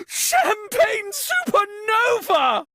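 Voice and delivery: high, shocked shout of a made-up oath; startled cry. Acted. Host startled by the blackout, high shocked shout. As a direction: yelp the oath in sudden alarm.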